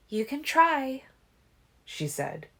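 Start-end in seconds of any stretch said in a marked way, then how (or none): 0.1-1.1 put-on higher, doubtful young woman; skeptical. A narrator's reading. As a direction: doubt him openly. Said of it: "her voice skeptical".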